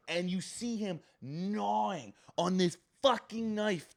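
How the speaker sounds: high, slow, drawn-out emphasis; horrified telling. Real, not acted. Horrified telling of gruesome scene.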